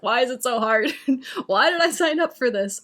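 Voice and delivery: hammed-up "poor me" protest; mock exasperated complaint. Acts out her bad-day complaint.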